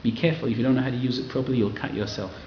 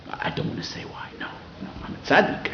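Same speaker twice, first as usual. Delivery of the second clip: whispered, as if sharing gossip; a gossip's hushed aside; mock whisper; 4 semitones higher, 5 dB quieter than usual. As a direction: lean in and whisper the secret. Mocking a gossiper's secrecy.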